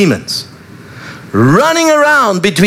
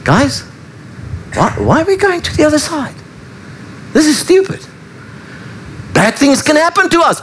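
higher-pitched, fretful grumbling among the crew; anxious disciples; 7 semitones higher, 3 dB quieter than usual. Acted. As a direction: voice a worried disciple whining in the boat. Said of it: "Can you hear the conversation?".

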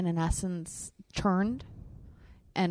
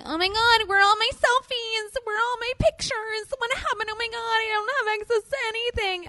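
much higher, frantic mock panic over lost photos; 5 dB louder than usual. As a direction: shriek like you've lost everything, hammed up. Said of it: "And you're like".

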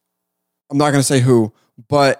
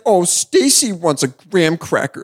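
higher, slow, mock baby talk; drawn-out cutesy parody; 4 semitones higher, slower than usual. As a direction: imitate a grown-up talking babyishly. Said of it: "doing baby talk to each other".

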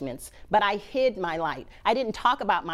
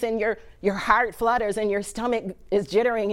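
ordinary delivery

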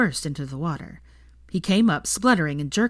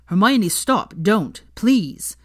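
louder, desperate cries to stop; loud panicked protest; panicked pleading; 3 semitones higher, 7 dB louder, much slower than usual. A narrator's reading. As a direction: shout her off before she finds it. Struggling for the box.

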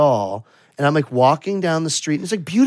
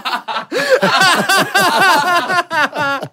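high, breathless burst of laughter; laughing fit; 14 semitones higher than usual. Real, not acted. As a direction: lose it laughing. Laughter fit after story beat.

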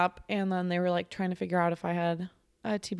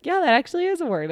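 higher, louder, quick surprised yes; dad's surprised approval; 9 semitones higher, 6 dB louder, faster than usual. Retold or imitated. Dad to toddler, amazed the word is real. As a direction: blurt it out, amazed.

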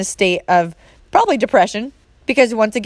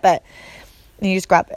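ordinary delivery

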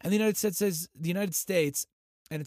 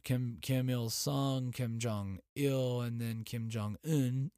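slow, deliberate naming of each; slow listing; slower than usual. Real, not acted. Host lists the three Kims, slow and deliberate. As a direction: spell out each name slowly. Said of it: "Three of 'em now".

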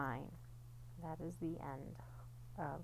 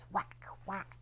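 whack sound effect; 5 dB quieter than usual. Acted. Stick hitting the piñata.

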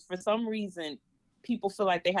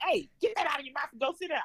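mock harsh scolding; 7 semitones higher, much faster than usual. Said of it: "Instead of me saying".

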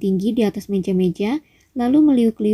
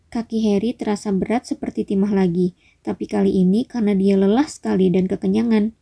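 ordinary delivery, nothing marked

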